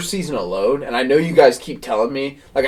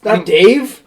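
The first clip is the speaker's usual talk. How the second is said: sharp high rising "Not Dave?" in disbelief; can't believe the friend missed it; surprised disbelief; 9 semitones higher, 4 dB louder than usual. Real, not acted. Asking a friend who never watched Dave, in high surprise.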